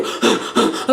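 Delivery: quick unvoiced gasps; acted hyperventilating gasp; panicked gasp. Acting out own panic.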